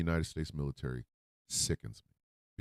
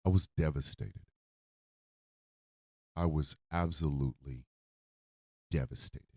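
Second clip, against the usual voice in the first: choked-up grief; 3 semitones lower, 5 dB quieter than usual. Real, not acted. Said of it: "makes me wanna cry".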